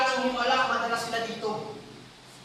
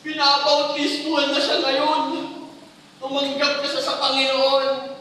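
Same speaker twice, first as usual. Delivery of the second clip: higher, louder, slow tearful plea; the head pastor telling the youth, louder and higher, pleading; pastor's tearful testimony; 6 semitones higher, 5 dB louder than usual. Retold or imitated. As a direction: voice the pastor pouring out his years of prayer. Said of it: "O sabi".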